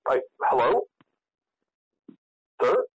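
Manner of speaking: ordinary talk, nothing marked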